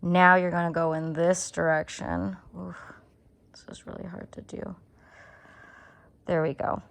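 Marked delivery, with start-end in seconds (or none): 2.6-2.8 strained oof. Real, not acted. Straining at a hard needle move. 3.5-4.8 strained whisper under effort. Real, not acted. Struggling with needles, whispers.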